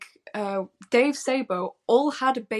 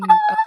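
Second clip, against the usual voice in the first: mock high note; 11 dB louder than usual. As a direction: screech it like the big high note. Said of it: "all the Living in Sin".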